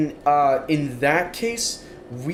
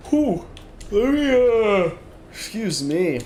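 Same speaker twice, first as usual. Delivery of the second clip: yawning; 7 semitones higher, slower than usual. Real, not acted. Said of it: "I'm freaking yawning like mad tonight".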